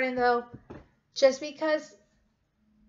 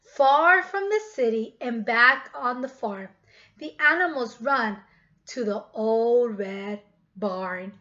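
loud, slow storyteller voice; storybook reading; 2 semitones lower, 5 dB louder, slower than usual. A narrator's reading. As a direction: read it out big and slow for the kids. Reads story to children.

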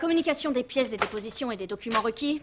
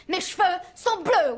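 louder and slower, exasperated; 6 semitones higher, 4 dB louder, slower than usual. Acted. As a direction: spell it out in frustration. Says it twice, differently.

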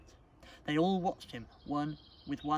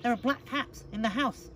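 high, loud, insistent kitten voice; urgent warning; 10 semitones higher, 6 dB louder than usual. Acted. Child repeats the ignored warning.

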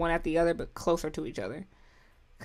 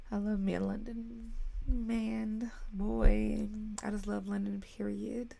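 breathy dreamy whisper, almost unvoiced; swooning whisper; 7 dB quieter, slower than usual. Real, not acted. Fan whispering over London boys, dreamy hush. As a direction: sigh it out like a crush.

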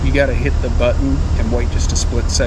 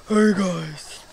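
higher-pitched, put-on greeting; playful redo; 5 semitones higher, 4 dB quieter than usual. Redoing the greeting after a voice complaint, playful higher voice. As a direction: lift the pitch for a silly hello. Redoes intro after voice remark.

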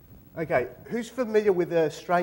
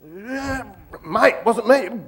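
raised, protesting blokey voice; loud, pitched-up denial; defensive mate; 5 semitones higher, 6 dB louder, much slower than usual. Acted. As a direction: protest your innocence. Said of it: "So the mate gets aggressive".

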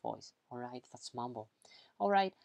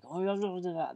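higher, louder grumbled complaint; grumbling; 4 semitones higher, 4 dB louder, faster than usual. Shown as a demonstration. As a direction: grumble run-together words in mock complaint. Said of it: "it's that you are complaining".

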